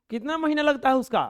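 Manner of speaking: loud mock yell; shouting girl. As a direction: yell it like a fierce little girl. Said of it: "वो एकदम चिल्ला के बोल रही थी".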